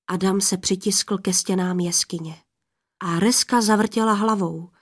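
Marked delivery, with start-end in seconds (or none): none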